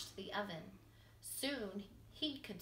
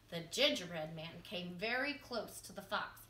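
plain reading voice

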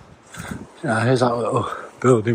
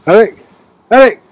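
high, loud call to a dog; 10 semitones higher, 11 dB louder than usual. Real, not acted. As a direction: call him back across the hill. Calling the dog back.

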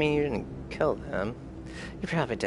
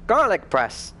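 excited exclamation; 8 semitones higher, 6 dB louder than usual. Real, not acted.

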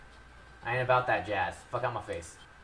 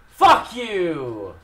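loud, high curse falling away; angry outburst; 4 semitones higher, 8 dB louder than usual. Real, not acted. Player cursing the game, angry outburst.

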